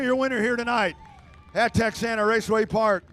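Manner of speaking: booming PA announcer call; race announcer. Acted. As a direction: announce the finish to the grandstand. PA announcer calling race finish.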